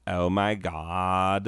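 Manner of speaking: slow, hammed-up camp gasp; exaggerated camp exclamation, done slowly; over-the-top camp. Shown as a demonstration. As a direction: overdo a flamboyant Oh my God. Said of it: "not gonna be over the top like".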